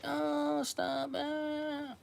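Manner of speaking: high, soft falsetto protest; mock coy girl. Japanese girl pretending to refuse, soft high falsetto. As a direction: squeak it out coyly. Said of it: "They're like".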